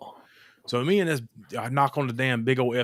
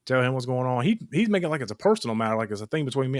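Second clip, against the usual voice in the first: usual speaking voice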